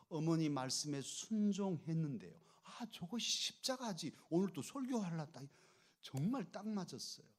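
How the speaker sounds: ordinary delivery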